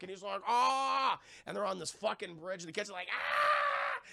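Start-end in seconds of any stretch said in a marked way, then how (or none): 0.5-1.2 high, loud "aah" of alarm; retold cry of alarm, pitched up; acted scream. Storyteller to viewers as the bus swerves, mock scream. Retelling bus passengers panicking on bridge. 3.1-4.0 very high shrieking "aah"; freaked-out scream. Retold or imitated. Retelling kids on the doomed bus, high mock shriek. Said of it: "the kids are all freaking out".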